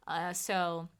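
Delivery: normal talking voice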